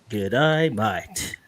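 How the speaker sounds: low, drawling Aussie g'day; hammed-up Australian greeting, falling away; Australian accent. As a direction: overdo the Australian vowels, dropping low. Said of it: "That's my best Australian accent".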